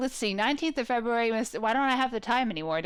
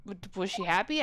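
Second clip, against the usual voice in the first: usual speaking voice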